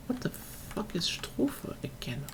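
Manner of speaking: whispered, slow, as if to himself; a soft private whisper while he searches for the word; whispered to self. Real, not acted. Searching for the English word, whispering to himself.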